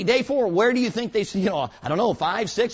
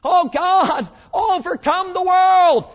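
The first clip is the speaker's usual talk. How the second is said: high, loud, slow wailing cry; wailing prayer; 11 semitones higher, 6 dB louder, much slower than usual. Retold or imitated. Believer crying out to God, loud high mock wail. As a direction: ham up a desperate begging prayer. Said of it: "we say".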